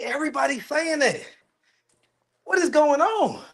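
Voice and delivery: high-pitched, real bewilderment; baffled disbelief. Real, not acted. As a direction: react in high, incredulous disbelief. Learns viewers hear a lisp.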